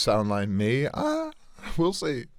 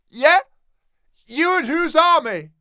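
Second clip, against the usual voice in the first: much higher, louder taunt; mocking bully; 7 dB louder than usual. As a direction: sneer at a nervous boy.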